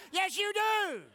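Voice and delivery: high, loud, slowing preaching shout; triumphant pulpit shout; shouted rebuttal. Acted. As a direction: answer the doubter with full force.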